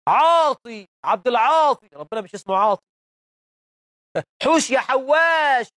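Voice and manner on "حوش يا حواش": mocking call. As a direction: ham up the folk invocation as a slow call. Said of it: "هو اسمه حواش ربنا".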